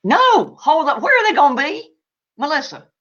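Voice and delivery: real surprised hold-up, then a question; sudden stop. Real, not acted. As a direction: cut yourself off, startled. Suddenly stops to answer question.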